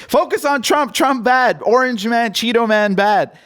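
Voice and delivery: high, childish mock chant, slowing at the end; mocking Biden yelling. Mocking Biden steering the reporter onto Trump, high sing-song. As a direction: dumb it down like talking to kids. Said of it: "he's just yelling at the reporter".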